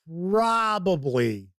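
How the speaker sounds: probably stretched very slow, falling; drawn-out probably. Real, not acted. Host hedging a promise to chat, very slow drawn-out. Hedging about next week's review.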